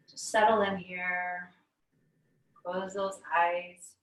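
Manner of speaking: calm meditation voice with very long pauses; guided meditation. Real, not acted. Yoga teacher guiding the class to notice breath, guided meditation. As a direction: lead them inward, leaving long silences between phrases.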